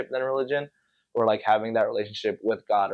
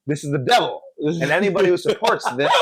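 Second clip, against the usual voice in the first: high, loud "Wrong!"; mock preachy shout; 5 semitones higher, 4 dB louder than usual. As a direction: bellow it like a fire-and-brimstone presenter. Mocking preachy presentation.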